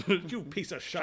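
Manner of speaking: mock Irish lilt on the insult; Irish accent. Joking about translating into Irish, mock Irish accent. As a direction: put on a broad Irish "shite". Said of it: "Especially in Ireland".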